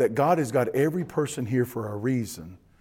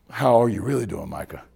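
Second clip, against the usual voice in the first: lower, softened check-in, dropping at the name; sincere; 5 semitones lower than usual. Acted. Pastor really asking Micah how he is, sincere and hushed. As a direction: ask it gently, wanting a real answer. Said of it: "slow down enough to really hear".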